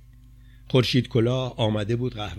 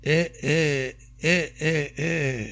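grieving moans; 4 semitones higher, slower than usual. Acted. Ali at the grave, moaning in grief. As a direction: moan out the pain in short breaths.

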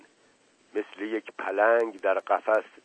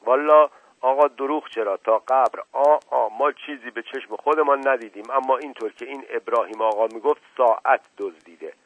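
loud, higher servant voice; raised-voice servant pleading honesty; servant's solemn disclaimer; 4 semitones higher, 5 dB louder than usual. Acted. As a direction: swear ignorance, then pass on the hearsay.

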